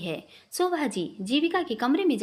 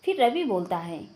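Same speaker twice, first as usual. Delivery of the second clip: ordinary delivery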